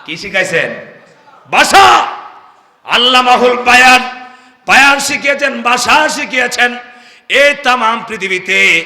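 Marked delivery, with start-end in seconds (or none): 1.5-8.8 loud, high preaching call; full-force sermon shout; fiery preaching. Acted. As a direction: preach it out to the crowd.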